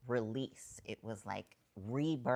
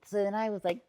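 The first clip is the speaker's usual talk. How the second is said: usual speaking voice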